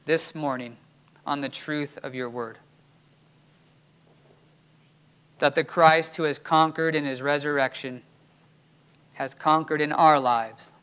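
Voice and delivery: low, hushed prayer to God. Real, not acted. Pastor prays to God after the sermon, hushed and reverent. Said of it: "Let's pray".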